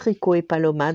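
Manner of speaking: ordinary delivery, nothing marked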